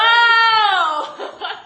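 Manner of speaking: loud, high laughing "Oh!"; mock shocked cry. Mother acting shock at seeing the babysitter naked. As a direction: gasp it out in horror.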